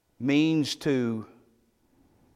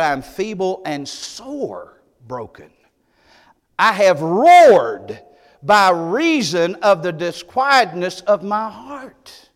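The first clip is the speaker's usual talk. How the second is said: anguished psalm; 4 semitones higher, 7 dB louder, slower than usual. Acted. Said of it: "He said".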